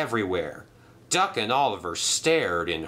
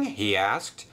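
usual speaking voice

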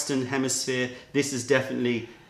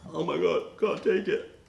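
higher, overwhelmed mock panic as the dog; Pretzel impression; 5 semitones higher than usual. Host voicing Pretzel overwhelmed by the tea rinse, mock panic. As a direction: voice the dog reeling from a strong smell. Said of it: "doing an impression of pretzel".

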